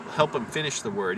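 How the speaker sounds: normal talking voice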